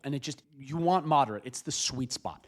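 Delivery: usual speaking voice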